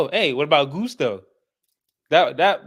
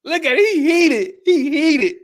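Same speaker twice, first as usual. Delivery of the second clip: much higher, louder, slow teasing; laughing tease; 14 semitones higher, 5 dB louder, slower than usual. Real, not acted. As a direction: crack up teasing him. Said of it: "laughing at friend".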